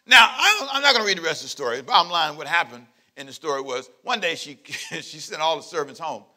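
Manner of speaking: ordinary talk, nothing marked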